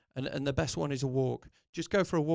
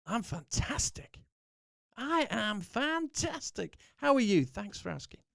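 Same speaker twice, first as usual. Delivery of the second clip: high, bursting with conviction; real conviction; 7 semitones higher than usual. Shown as a demonstration. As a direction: proclaim it like you truly mean it. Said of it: "say it with real conviction".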